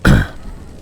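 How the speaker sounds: low, rough throat-clear. Real, not acted.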